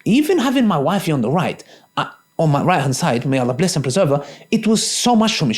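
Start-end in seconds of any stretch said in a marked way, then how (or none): none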